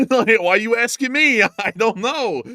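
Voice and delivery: high laughing protest. Real, not acted. Laughing at his cohost's question, high and giggly. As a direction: giggle through the denial. Laughing at odd map sight.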